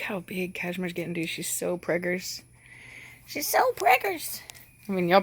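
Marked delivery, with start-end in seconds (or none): 3.3-4.4 high, slow, drawn-out coo; gushing squeal. Real, not acted. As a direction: gush over it in a high, stretched voice. Squealed repeat about pregnant animal.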